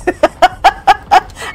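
high embarrassed giggle. Real, not acted. After scaring her cats, high giggle.

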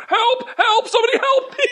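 high laughing mock help cries; mock cry for help. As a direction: squeal for help, hamming it up. Tied up naked on stage.

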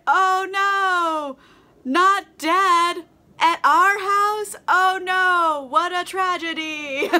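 high, loud, drawn-out false dismay; badly faked shock. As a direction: play innocent with hammed-up horror. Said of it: "Just kind of playing it off".

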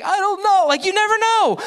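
high-pitched, exasperated defense; hammed-up flustered plea of ignorance; flustered protest. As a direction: protest helplessly, pitch shooting way up. Flustered reply to angry officer.

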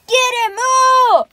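high loud alarmed yell; panicked shouting. Acted. Chasing cougher in panic.

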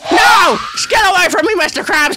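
high, yelled mock panic; hammed-up panic; play panic. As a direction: yell it high as if fleeing a monster. Fleeing evil Krabs in game.

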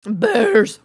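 sudden loud shout; loud exclamation. Real, not acted. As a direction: blurt it out loud.

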